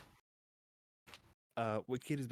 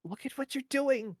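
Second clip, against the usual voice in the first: high, loud distressed appeal; pleading; 14 semitones higher, 6 dB louder than usual. Acted. Wicked confronts Feynman draining the corpse, high pleading. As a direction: implore in character, voice pitched way up.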